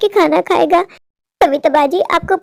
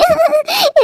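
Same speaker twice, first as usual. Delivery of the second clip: sob; 9 semitones higher than usual. Acted. Widow sobs alone.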